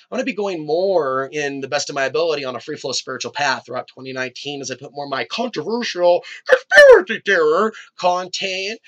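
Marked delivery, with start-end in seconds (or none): 5.2-8.8 loud, high, stretched-out put-on voice; mock sensational. Host teasing his own conspiracy content, mock sensational. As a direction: hype it up in a silly exaggerated voice.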